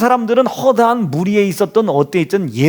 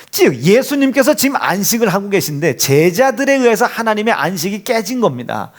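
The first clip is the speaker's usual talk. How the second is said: normal talking voice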